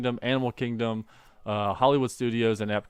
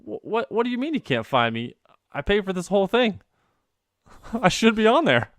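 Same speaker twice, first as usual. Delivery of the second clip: higher, rising outrage; higher, faster, building to a laugh; indignant disbelief; 9 semitones higher than usual. Retold or imitated. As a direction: protest like you paid for it all. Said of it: "And I was like".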